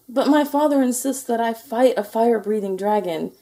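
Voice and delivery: high-pitched complaint; whiny moan of a let-down little knight. A narrator's reading. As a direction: protest in a high, plaintive knight's voice. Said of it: "Sir George moaned".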